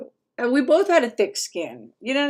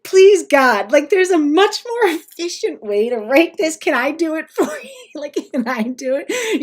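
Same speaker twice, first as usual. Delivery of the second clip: frustrated plea, higher and louder; barely contained impatience, pitched up; exasperated pleading; 6 semitones higher, 8 dB louder than usual. Retold or imitated. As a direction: beg in exasperation to take over, half laughing. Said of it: "I would get real frustrated".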